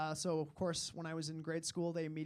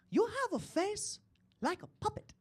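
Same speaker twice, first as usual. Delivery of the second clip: exchange student impression; 14 semitones higher than usual. Exchange student turns to him at a party, high mimicking voice. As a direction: put on a high voice, spacing the words out.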